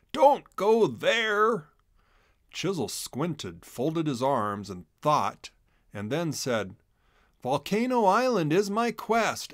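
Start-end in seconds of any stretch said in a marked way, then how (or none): 0.1-1.7 slow, higher and louder plea; urgent warning. A narrator's reading. Pumpkin warns Chisel, slow and pleading. 7.4-9.5 higher, proud announcement; determined declaration. A narrator's reading. Chisel tells Pumpkin his quest, proud and bold.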